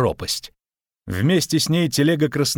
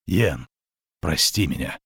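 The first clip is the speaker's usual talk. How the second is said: subdued, lower plea for forgiveness; low apology; 7 semitones lower than usual. Acted. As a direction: ask forgiveness, dropping the voice low. Desperate apology while both dangle.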